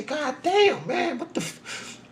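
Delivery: high, stunned cry of disbelief; shocked outburst. Retold or imitated. Man finding dead birds round his car, stunned high outcry. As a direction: gasp it out like you can't believe your eyes.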